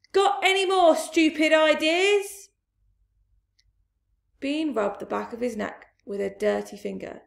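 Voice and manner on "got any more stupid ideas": high, loud angry shout; shouted retort. Acted. Bunce shouting back at deaf Bean, angry yell. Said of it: "Bunce shouted back".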